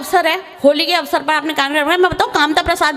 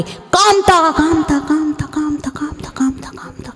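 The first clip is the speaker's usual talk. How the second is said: mock echo; slower than usual. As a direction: call then echo yourself dying away. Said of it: "Kamta ने आवाज़ लाई". (पुकार जो गूंज बनकर फुसफुसाहट में खो जाए)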